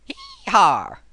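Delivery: loud, high southern "hee-haw"; hammed-up cowboy whoop in a southern accent; bad southern accent. Shown as a demonstration. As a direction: holler it like a cartoon southerner. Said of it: "terrible butchery of a southern accent".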